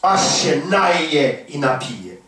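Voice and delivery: very slow, each word weighed; slow emphatic reading. Shown as a demonstration. Preacher reading the verse to the congregation, very slow. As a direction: let the verse sink in slowly. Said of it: "preacher drawing out the verse".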